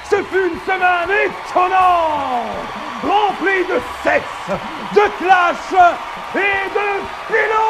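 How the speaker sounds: loud, slow, high game-show host; TV host announcement. Acted. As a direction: open the show like a TV presenter.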